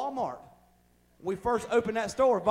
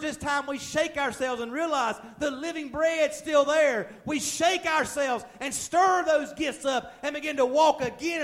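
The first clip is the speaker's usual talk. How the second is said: loud, high-pitched preaching in driving runs; fervent preaching; 5 semitones higher, 5 dB louder than usual. Acted. Preacher rallying the church to stir up the anointing, fervent preaching. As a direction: preach it at full force, pitch held up.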